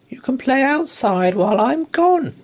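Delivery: high mother rabbit voice. Acted. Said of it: "she told her children".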